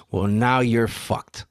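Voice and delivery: drawn-out, understated pronouncement; deadpan verdict. Retold or imitated. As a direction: deliver it slowly, without yelling. Retold cop's verdict, first take.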